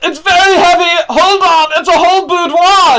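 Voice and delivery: high, loud strained call through the door; high loud shout of effort. Acted. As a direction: yell while heaving something heavy. NPC straining behind a door.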